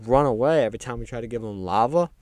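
ordinary talk, nothing marked

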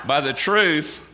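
slow, laughing at own voice crack; laughing through it. Real, not acted. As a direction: chuckle while repeating By the truth. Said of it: "sorry, I'm going through puberty".

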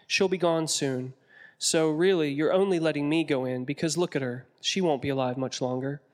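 usual speaking voice

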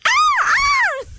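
high piercing seagull squawk; seagull impression. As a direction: screech it like a seagull. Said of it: "sounded like a seagull".

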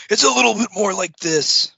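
high and loud with gravel in the voice, imitating Brett; gravelly Brett. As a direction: growl it like Brett. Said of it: "more gravel to his voice".

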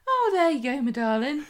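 high, bright mock "Oh, there you go, my darling"; imitating Sarah's catchphrase. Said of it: "every time she says".

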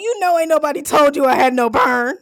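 indignant. Retold or imitated. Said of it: "retelling to dad".

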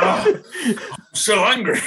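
high-pitched, laughing imitation of anger; hammed-up high-voiced crossness; mock fuming. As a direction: play someone else's rage for laughs. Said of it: "this fucking fuming mood".

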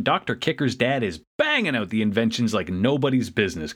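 ordinary delivery

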